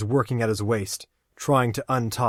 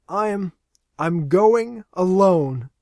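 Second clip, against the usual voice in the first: sobbing; 9 semitones higher, 7 dB louder, much slower than usual. Acted.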